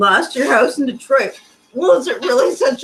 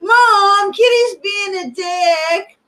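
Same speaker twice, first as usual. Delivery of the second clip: high, slow whiny cartoon kid; South Park kid voice; 11 semitones higher, 4 dB louder, slower than usual. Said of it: "That was South Park".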